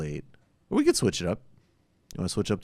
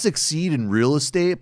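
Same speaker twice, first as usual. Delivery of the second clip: hammed-up infomercial host, higher voice; infomercial pitchman; 5 semitones higher, 4 dB louder than usual. Host pitching socialism to buyers, infomercial voice. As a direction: sell it like a cheesy infomercial. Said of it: "like one of those infomercials".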